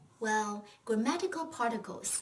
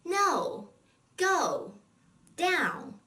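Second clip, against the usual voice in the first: no, go, down each hit hard and falling, higher and louder; emphatic falling words; 7 semitones higher, 5 dB louder, much slower than usual. Shown as a demonstration. Teacher showing the fourth tone feel: emphatic falls. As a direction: say no, go, down like firm commands. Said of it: "the fourth tone is like a strong, emphatic statement".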